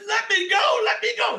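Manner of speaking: loud, very high panicked yell; screaming demon. A narrator's reading. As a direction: scream to be released from the hammer. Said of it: "he starts screaming and screaming".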